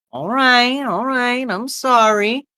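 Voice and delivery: high-pitched, placating woman's voice; high, singsong backing down; waitress backing off. Acted. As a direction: apologise lightly in a high waitress voice. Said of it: "a woman comes by and goes".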